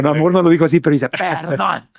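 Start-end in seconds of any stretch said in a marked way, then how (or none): 1.1-1.9 drawn-out mock-indignant "perdón", softer; dramatised reading. Host re-enacting a listener's complaint, hammed-up indignation. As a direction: play up the offended listener. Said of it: "lo voy a interpretar yo".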